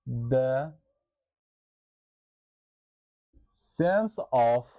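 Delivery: slow, word-by-word dictation pace. Shown as a demonstration. Teacher dictating a definition to the class, slow and deliberate. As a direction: dictate each word slowly so students can write. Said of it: "teacher dictating definition for notes".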